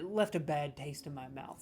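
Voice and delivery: normal talking voice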